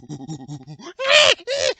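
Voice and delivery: imitated gorilla hooting and shrieking; gorilla call. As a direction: go wild like a gorilla, ending in a high screech. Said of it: "And the gorilla's like".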